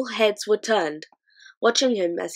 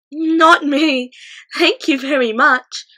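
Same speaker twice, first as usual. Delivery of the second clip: put-on higher, louder girl saying no; firm girl's refusal; 7 semitones higher, 9 dB louder, slower than usual. A narrator's reading. Sophie refusing to go out, firm girl voice. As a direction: refuse firmly like a little girl.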